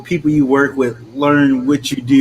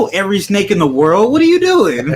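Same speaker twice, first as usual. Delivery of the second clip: exasperated retort; 7 semitones higher, faster than usual. Retold or imitated. Exasperated retort to random snake questions.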